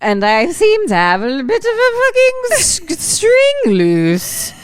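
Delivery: high, loud fussy socialite; posh lady voice. Retold or imitated. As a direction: play a spoiled gala guest in a panic. Mocking a posh gala customer.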